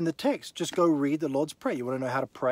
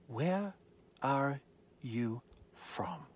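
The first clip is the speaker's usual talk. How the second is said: slow hushed whisper; whispered question; 2 semitones lower, 9 dB quieter, much slower than usual. Acted. Pilate asking Jesus in a hushed whisper. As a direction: breathe the question slowly.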